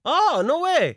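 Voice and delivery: high, delighted "no way"; surprised. Real, not acted. Host hears he shares a birthday, high and surprised. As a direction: sound genuinely caught off guard.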